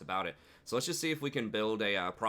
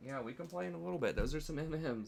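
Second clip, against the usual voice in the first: much higher, soft coaxing; 8 semitones higher, 5 dB quieter than usual. Real, not acted. Dad soothes toddler.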